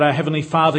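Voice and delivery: normal talking voice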